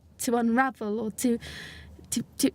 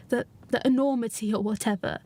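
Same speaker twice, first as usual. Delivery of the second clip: ordinary delivery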